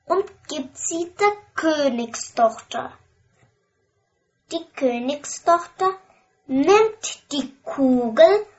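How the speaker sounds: ordinary delivery, nothing marked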